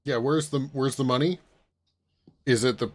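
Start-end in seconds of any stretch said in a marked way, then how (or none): none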